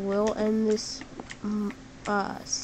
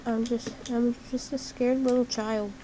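normal talking voice

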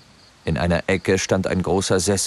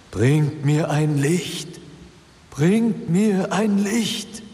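very slow, high, eerie wail; whimpering ghost; 11 semitones higher, much slower than usual. A narrator's reading. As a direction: plead like a lost spirit. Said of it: "wimmerte die Stimme ohne Unterlass".

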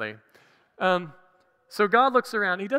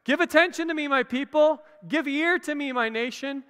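higher and louder, calling out; ringing emphatic call; proclaiming; 7 semitones higher, 7 dB louder than usual. Shown as a demonstration. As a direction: proclaim it like a summons to the people. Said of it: "it's the same idea".

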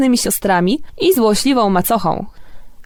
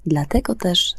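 story narration; 3 semitones lower than usual. A narrator's reading. Radio host to the children listening, bedtime story narration. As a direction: tell it like a bedtime fairy tale. Fairy tale read on air.

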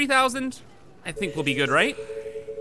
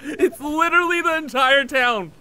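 loud, high, through laughter; laughing excitedly; 3 semitones higher, 3 dB louder than usual. Real, not acted. Gamer amazed at the burning town, laughing loudly. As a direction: blurt it out while cracking up.

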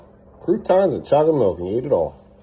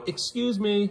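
polite excuse me; 12 semitones higher, much slower than usual. Shown as a demonstration. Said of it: "What do we say when we burp".

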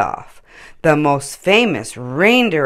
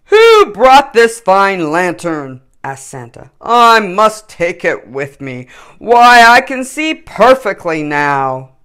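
louder, slow, delighted Santa; curious Santa; 4 semitones higher, 9 dB louder than usual. A narrator's reading. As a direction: marvel like Santa. Santa's voice in story.

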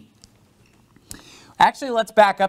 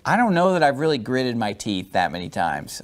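ordinary delivery

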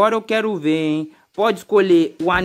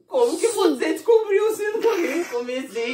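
excited praise; 8 semitones higher, 3 dB quieter, slower than usual. Real, not acted. Praising son, high voice.

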